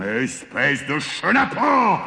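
high, drawn-out giant roar of fury. A narrator's reading. Tricked giant insults the farmer, roaring. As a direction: growl into a slow, outraged roar. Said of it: "rugit le géant".

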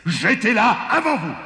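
high, piercing indignant voice; enraged giant. A narrator's reading. Giant claims he was there first, enraged. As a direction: insist furiously in a strident giant voice.

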